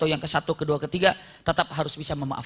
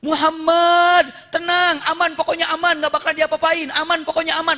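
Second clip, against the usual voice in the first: high, raised-voice calling; frightened reassurance; 10 semitones higher, 5 dB louder than usual. Acted. Scared Suraqah promises Muhammad no harm, acted anxious calling. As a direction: insist all is safe while acting scared. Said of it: "Padahal dirinya yang ketakutan".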